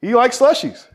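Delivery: high, loud pleading vendor; street vendor voice. Retold or imitated. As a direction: defend yourself as a vendor. Voicing the slushie vendor.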